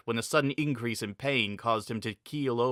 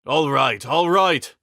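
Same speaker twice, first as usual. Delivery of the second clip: louder, hasty surrender; giving in; 4 semitones higher, 8 dB louder, slower than usual. A narrator's reading. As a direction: back down quickly. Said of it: "the Blue Knight replied".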